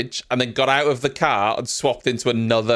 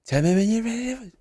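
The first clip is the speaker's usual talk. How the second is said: laid-back rap voice, a bit higher; 6 semitones higher than usual. Retold or imitated. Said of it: "I'm trying to convey [name]".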